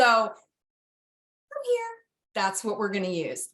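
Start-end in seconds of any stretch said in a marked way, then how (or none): 0.0-1.9 high sing-song "Come here" pitched way up; dog-centric voice. Shown as a demonstration. Trainer showing the recall cue, high and sing-song. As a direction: make it all about the dog. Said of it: "that dog-centric voice".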